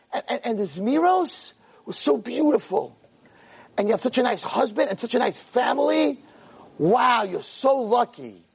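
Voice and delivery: high-pitched, drawn-out gushing; gushing admiration. Acted. Teenage guest praises her friend's mother after Shabbos, gushing admiration. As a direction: marvel slowly at every compliment.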